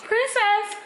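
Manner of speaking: high loud call across the house. Real, not acted. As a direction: call her name from afar. Calling out to someone.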